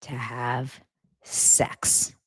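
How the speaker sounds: slow, low, word by word; long loaded pauses between words, imitating him; mock-seductive quote. Mock-seductive quote of Cody over dinner.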